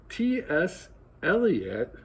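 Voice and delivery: slow, drawn-out puzzled echo; slow mournful bafflement; funereal puzzlement. Acted. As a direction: repeat the name as if it were a riddle at a funeral. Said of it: "echoed with the same funereal puzzlement".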